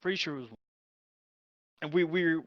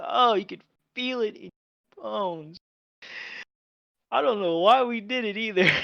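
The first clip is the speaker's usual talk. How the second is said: normal talking voice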